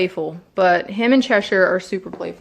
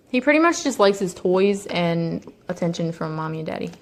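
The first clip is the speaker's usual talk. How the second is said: ordinary talk, nothing marked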